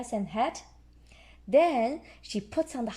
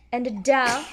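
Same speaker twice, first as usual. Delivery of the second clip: ordinary delivery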